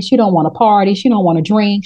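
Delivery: ordinary talk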